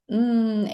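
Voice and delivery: "Mm" hummed on fully closed lips; sustained M sound. Shown as a demonstration. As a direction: demonstrate the consonant as a held "Mm". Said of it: "you have to close your lips".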